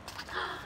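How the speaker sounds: soft quick gasp; hushed intake of surprise; anticipating gasp. A narrator's reading. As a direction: gasp before the reveal. Anticipates the apple.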